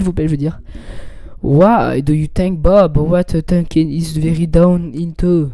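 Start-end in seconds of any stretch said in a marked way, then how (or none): 1.4-5.5 slow, halting English, French accent. Real, not acted. Streamer reading English aloud for viewers, French-accented English. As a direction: labour through English with a thick French accent. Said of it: "meilleur accent de l'histoire".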